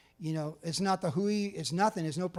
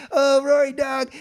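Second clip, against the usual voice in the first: enthusiastic greeting; 7 semitones higher, 9 dB louder, slower than usual. Retold or imitated. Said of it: "And I'm like".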